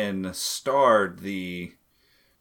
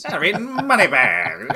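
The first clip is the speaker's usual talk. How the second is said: put-on high, loud old-timey gangster voice; 1920s booze runner; 7 semitones higher, 8 dB louder than usual. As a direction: bark it like a Prohibition bootlegger. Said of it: "You said it like a nineteen twenties, like, booze runner".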